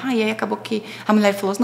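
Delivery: ordinary delivery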